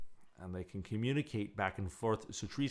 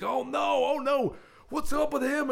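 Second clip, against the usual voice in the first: high, loud mock panic; mock alarm; 7 dB louder than usual. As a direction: imitate a crowd of panicked onlookers. Voicing alarmed diners in retold scene.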